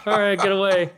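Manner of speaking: mock side-hug dismissal with a laugh; laughing brush-off. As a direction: shoo them off through a laugh. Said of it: "that annoying side hug".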